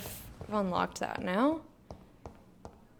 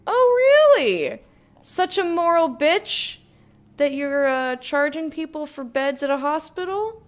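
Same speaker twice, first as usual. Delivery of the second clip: mocking, higher and louder, slow on "such a moral bitch"; sarcastic retort; 7 semitones higher, 6 dB louder than usual. Real, not acted. Gamer scoffs at the sister's letter, with loud mocking sarcasm. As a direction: sneer back at the letter's insult.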